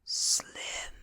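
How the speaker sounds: weak, voiceless answer; whispered. A narrator's reading. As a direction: breath-only whisper of a dying man. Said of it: "he whispers".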